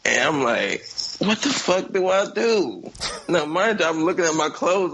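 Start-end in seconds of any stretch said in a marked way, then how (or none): 1.2-2.9 slow, high mock panic; panicked. Caught naked in bed, slow high mock panic. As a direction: wonder aloud in dread.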